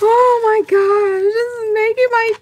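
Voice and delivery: real overwhelmed, tearful squeal; happy tearful. Real, not acted. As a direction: wail it high, moved to tears. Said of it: "Girl, you gonna make me cry".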